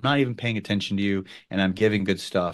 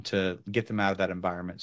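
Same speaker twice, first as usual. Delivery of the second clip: ordinary talk, nothing marked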